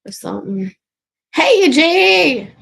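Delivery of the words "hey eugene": loud, slow call across the room; shouting a greeting to a chat viewer. Real, not acted. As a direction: call his name out slowly and loudly.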